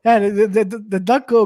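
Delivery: usual speaking voice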